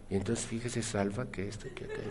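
barely audible mock mumble; imitating a mumble. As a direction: fade the words into a near-silent murmur. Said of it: "y me habla así".

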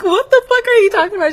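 high, fast, laughing disbelief; shocked outrage. Retold or imitated. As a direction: squeal it out in shock. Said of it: "And I was like".

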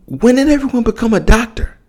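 high-pitched, incredulous outburst; exasperated rant. Real, not acted. Telling ESPN's pundits to shut up, high exasperated yell. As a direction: pitch rising as the frustration boils over.

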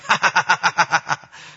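put-on impish laughter; imitated kid's laugh. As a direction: cackle like a mischievous little boy. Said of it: "And then he's".

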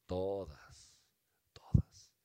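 awed whisper; hushed, intense whisper. Acted. Repeated word, awed emphasis.

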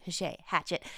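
Hachette first as "ha-SHAY", then as "HATCH-it"; alternating pronunciations. Shown as a demonstration. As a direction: waver between French Hachette and English hatchet. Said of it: "it should be pronounced hatchet".

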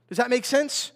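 ordinary talk, nothing marked

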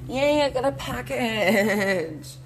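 drawn-out, high, loud cheer; excited squeal. Real, not acted. Vlogger finding a package at the door, excited squeal. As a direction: squeal it out long in delight.

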